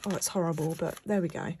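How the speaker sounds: usual speaking voice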